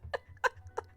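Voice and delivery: high and loud, breaking into laughter; barely holding it together; laughing. Real, not acted. Laughing about blocked pathfinding.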